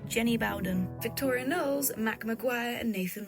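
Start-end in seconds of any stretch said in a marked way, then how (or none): none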